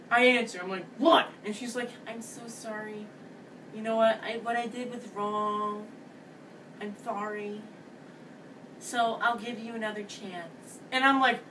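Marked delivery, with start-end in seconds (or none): 1.0-1.3 sharp, loud, higher "What?"; loud irritated answer; annoyed what. Retold or imitated. Answering annoying ex's fifth call. 2.1-10.8 soft, much quieter, put-on girl's voice; quiet imitated ex apologizing; mock apology.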